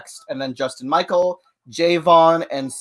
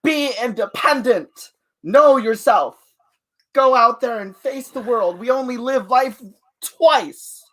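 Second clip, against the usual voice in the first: grand pep talk; 7 semitones higher, 5 dB louder than usual. Acted. Dramatic life advice.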